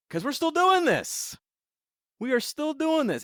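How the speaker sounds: fed-up, incredulous emphasis; exasperated. Real, not acted. Exasperated at storyline still running.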